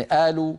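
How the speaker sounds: "قالوا" said alu, with a glottal stop for q; qaf as hamza. Shown as a demonstration. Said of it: "قلب القاف همزة".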